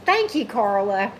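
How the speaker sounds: normal talking voice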